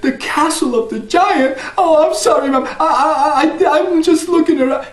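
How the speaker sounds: put-on high, stammering scared boy; frightened boy. Jack stammers a frightened apology to the old woman, high.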